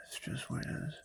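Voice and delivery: whispered. Real, not acted. Catchphrase said under breath.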